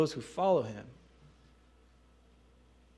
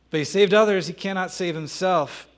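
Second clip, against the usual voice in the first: louder, higher sneering taunt; mocking jeer; 4 semitones higher, 7 dB louder than usual. Priests mocking Jesus on the cross, louder sneering jeer. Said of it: "they're mocking Jesus on the cross".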